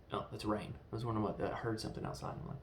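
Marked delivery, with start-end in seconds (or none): none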